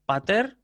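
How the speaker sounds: "Pater" as pa-TER, rising on the end; stress on final syllable. Shown as a demonstration. Teacher drilling the contrast, final stress on "Pater". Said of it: "con acento agudo al final".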